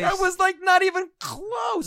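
yelled high and loud; shouting. Real, not acted. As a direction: shout it out in protest. Shouted protest.

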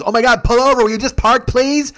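high, loud mock pleading; impatient passenger impression, loud and high; frantic pleading. Ron begs him to park.

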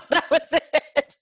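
giggling through the words, pitched high; real laughter breaking through; laughing. Real, not acted. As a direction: laugh it out, amused at herself. Laughing at her own story.

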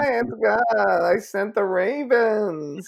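high, small squeaky God voice, slow at the end; tiny voice of God. Pretend. Friend voicing God bragging about the ravens, high squeak. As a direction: play God as a teeny proud squeak. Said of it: "That's the still small voice".

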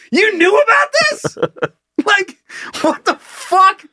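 very high-pitched, disbelieving challenge; incredulous outburst. Retold or imitated. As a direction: squeal it in outraged disbelief, then stretch it out. Disbelieving challenge to the janitor retold.

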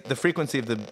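normal talking voice

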